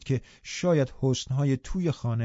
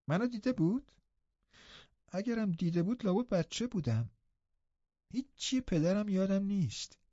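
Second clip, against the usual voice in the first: put-on higher, soft and hesitant boy voice; frail boy Linton, uneasy; 8 semitones higher, 6 dB quieter than usual. A narrator's reading. Linton asks Nelly whether he looks like his father, unsure.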